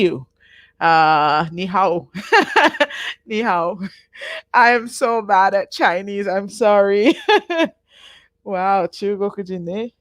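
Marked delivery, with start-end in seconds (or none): 2.1-3.2 short high laugh at her own Chinese; embarrassed laugh. Real, not acted. A teacher laughing at her own bad Chinese, high laugh. 7.2-7.7 quick high giggle; sheepish laugh. Real, not acted. The teacher apologising to Alex, quick high giggle.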